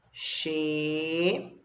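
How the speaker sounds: "Xereta" as xe, re, ta, with long pauses; slow syllable separation. Shown as a demonstration. Teacher dictating while separating, long gaps between syllables.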